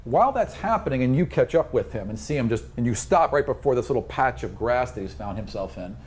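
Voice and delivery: normal talking voice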